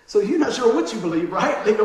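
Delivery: usual speaking voice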